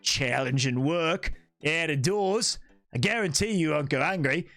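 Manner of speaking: rough Australian character voice; the Sniper from Team Fortress 2. Acted. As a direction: do an Aussie hitman's sales pitch, mostly low. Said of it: "the sniper from Team Fortress two".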